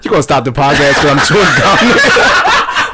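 laughing. Real, not acted. Laughing indignant retort.

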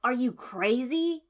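loud, slow, incredulous; disbelief. A narrator's reading. Kiba to the heroine, loud disbelief. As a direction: hit it like he can't believe it. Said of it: "Kiba asked in disbelief".

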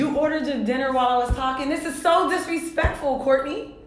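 high, loud playful indignation; mock outrage. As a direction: act offended, pitch way up. Mock outrage at cohost ordering.

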